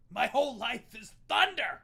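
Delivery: booming declaration; dramatic shout. Acted. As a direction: proclaim it like thunder. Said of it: "That's an aggressive".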